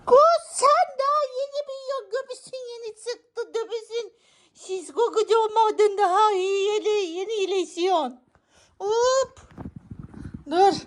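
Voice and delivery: very high, cooing pet voice. Real, not acted. Owner cooing at her recovering cat in baby talk.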